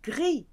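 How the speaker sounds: gris as a high French gree; silent S. Shown as a demonstration. Teacher shows the rule, gris with silent S. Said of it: "You cannot hear the S".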